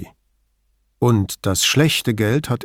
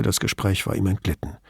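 plain reading voice

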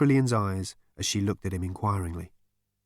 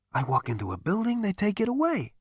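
bewildered complaint climbing very high; protest, voice rising in disbelief; perplexed complaint; 12 semitones higher than usual. A narrator's reading. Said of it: "shook his head in perplexity".

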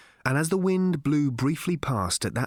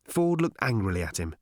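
ordinary delivery

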